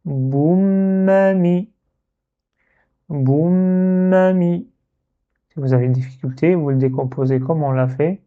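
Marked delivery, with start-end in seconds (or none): 0.0-1.7 "Boum, mi" as one word "boummami", long hummed m; doubled m. Shown as a demonstration. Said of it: "et mim avec kasra". 3.1-4.7 "Boum, mi" as one word "boummami", long hummed m; doubled m. Shown as a demonstration. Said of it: "et mim avec kasra".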